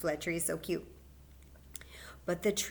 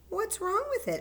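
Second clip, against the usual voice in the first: much higher, concerned mother; gentle mommy voice; 13 semitones higher, 3 dB louder than usual. Acted. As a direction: ask like a caring mom. Mommy asks what's wrong.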